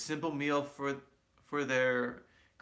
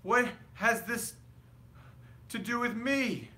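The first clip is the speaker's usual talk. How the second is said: high, strained, alarmed; 11 semitones higher than usual. A narrator's reading. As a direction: squeak it out in alarm. Scrooge pleading with ghost.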